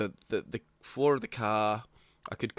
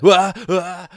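mock waking groan; 6 semitones higher, 10 dB louder than usual. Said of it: "And I was like".

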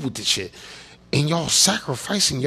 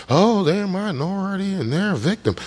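higher-pitched mocking singsong; mock-sympathetic refrain, pitched up; mocking parroting; 4 semitones higher than usual. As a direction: parrot a tired talking point in a high voice. Mimicking how politicians talk about victims.

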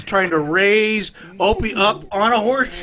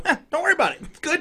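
mock government shrug; 8 semitones higher, faster than usual. Mocking government voice.

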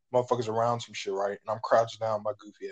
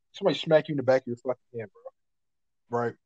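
usual speaking voice

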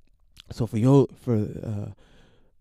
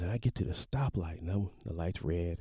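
ordinary delivery

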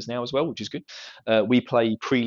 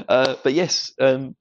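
normal talking voice

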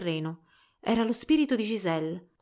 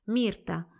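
plain reading voice

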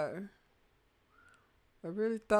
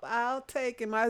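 ordinary delivery

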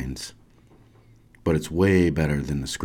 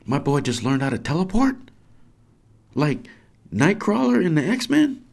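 high, thrilled disbelief; flipping out; 9 semitones higher than usual. Acted. Comic geek parent marvelling at the son, flipping out. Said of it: "wants to flip out".

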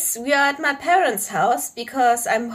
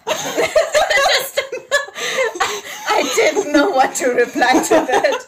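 talking through a laughing fit; real, breathless giggling; helpless laughter; 8 semitones higher, slower than usual. Real, not acted. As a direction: squeeze the words out between laughs. Cracked up, cannot reply.

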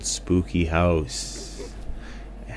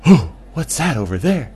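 frightened gasp; 6 semitones higher, 6 dB louder than usual. Acted. Gasp then frightened question.

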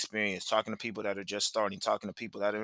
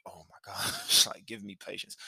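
weary plea; 3 semitones lower than usual. Retold or imitated. Dad in the front seat as kids cry, weary plea. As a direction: plead under your breath, half laughing.

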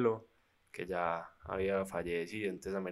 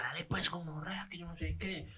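higher, hushed mocking robber voice; robber's taunt; 8 semitones higher, 7 dB quieter than usual. Robber urging the nervous friend, higher, hushed mockery. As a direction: egg him on like the thug, softly and higher. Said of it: "Y el piro le decía".

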